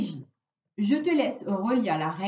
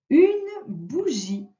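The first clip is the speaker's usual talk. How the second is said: slow dictation; 5 semitones higher, much slower than usual. Shown as a demonstration. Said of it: "Le premier mot".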